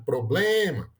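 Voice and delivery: "Problema" with BLE drawn out; proBLEma, demonstrating the strong middle syllable; stressed penultimate syllable. Shown as a demonstration. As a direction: lean long on BLE in "Problema". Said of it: "na penúltima sílaba".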